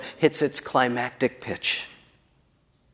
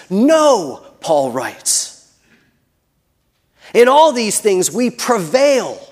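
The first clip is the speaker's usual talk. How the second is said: "No" and "all" pushed high and loud; emphatic proclamation; 5 semitones higher, 4 dB louder, slower than usual. Real, not acted. Preacher declaring victory through Christ, emphatic on "No" and "prevail". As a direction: stretch "prevail" slowly, pitched high.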